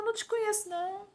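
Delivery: high, firm voice of the Lord refusing; Lord's rejection. Retold or imitated. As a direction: turn the pleader away. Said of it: "Ele fala". (o Senhor rejeitando, voz aguda e firme)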